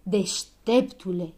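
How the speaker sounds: slow, drawn-out jibe; exasperated, drawled storybook retort; sarcastic outburst. A narrator's reading. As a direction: burst out, stretching the mock compliment. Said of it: "izbucni Dondănel".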